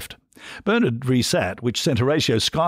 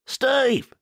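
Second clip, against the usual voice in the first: much higher bellowed name. A narrator's reading. The wife calling her husband from the bar, bellowing. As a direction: holler across the pub. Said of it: "she bellowed".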